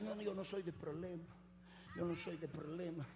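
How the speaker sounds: very soft, shrinking excuse; meek coward. Acted. Coward excusing himself from confrontation, meek quiet voice. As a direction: back away quietly, avoiding trouble.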